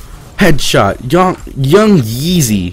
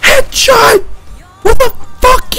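shocked shout. Real, not acted. Gamer shrieking after a sudden headshot death. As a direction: yelp at getting headshot.

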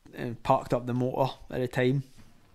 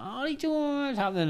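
high, soft mimicked hello; imitated chirpy greeting; 11 semitones higher, 7 dB quieter than usual. One of the young boys greets the coach, imitated high and soft. Kids greeting him by name.

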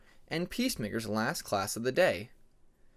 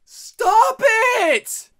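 full scream, much higher and louder; 15 dB louder than usual. A narrator's reading. Peacemaker screaming to silence the argument, full scream. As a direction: scream it into the air. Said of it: "Peacemaker screamed out into the air".

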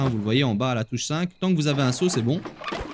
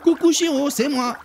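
high, loud cheerful hello; playful pitched-up viewer voice; imitated viewer greeting; 10 semitones higher, 3 dB louder than usual. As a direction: chirp it like a fan saying hi. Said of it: "vous souhaitez faire un petit coucou".

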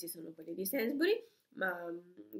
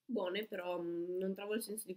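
talking while chewing food; muffled speech around bite; mouth full, chewing; 4 dB quieter than usual. Real, not acted. Seen 1: Left woman bites and chews with full cheeks; speaker unsure.

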